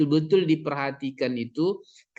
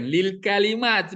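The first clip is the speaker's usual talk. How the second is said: "lil kalimat" slow, raised and deliberate; emphatic contrast; 4 semitones higher, 3 dB louder, slower than usual. Shown as a demonstration. Teacher points out the key term, slow emphatic "lil kalimat". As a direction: spell out "lil kalimat" slowly for attention. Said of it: "Ini perhatikan".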